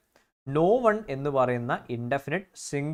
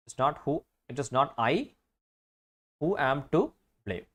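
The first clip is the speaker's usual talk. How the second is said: slow correction; much slower than usual. Shown as a demonstration. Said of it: "Is അല്ല".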